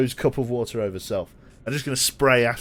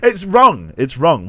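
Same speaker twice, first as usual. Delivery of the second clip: loud protest; 6 semitones higher, 11 dB louder, slower than usual. Real, not acted. Loud mock protest at yawning.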